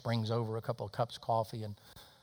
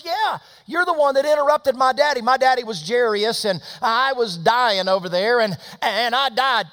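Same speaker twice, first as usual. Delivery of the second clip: high, loud, breathless-with-news retelling; excited girl; 12 semitones higher, 13 dB louder than usual. Retold or imitated. Girl tells waitress how they met, excited. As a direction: belt out the story at high pitch.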